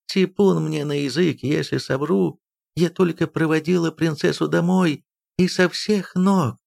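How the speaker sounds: high, mildly tearful plea; tearful pleading. A narrator's reading. Nursemaid swears she only brought the princess home, mildly tearful. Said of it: "Эйрме рыдала".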